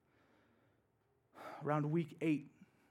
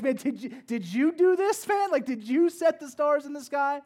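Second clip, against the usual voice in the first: a hint of a booming God, louder and higher; voicing God to Job; 10 semitones higher, 13 dB louder than usual. God questions Job, lightly voiced, louder and higher. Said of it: "where he's like".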